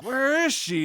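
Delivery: high-pitched acted demand; mock demanding. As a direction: play an urgent demand, lightly hammed up. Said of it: "He's demanding where Yuna is".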